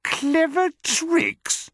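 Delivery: very high, drawn-out scornful squeal; incredulous cry. Acted. Smaller crocodile scoffs at the plan, incredulous cry. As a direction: echo it slowly, way up high. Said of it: "cried the not-so-big one".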